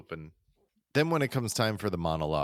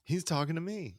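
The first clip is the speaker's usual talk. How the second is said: higher-pitched, lightly hammed-up thrilled fan; a mock awed audience member, pitched up; starstruck fan; 9 semitones higher than usual. Co-host playing an audience member thrilled by the star, mock starstruck.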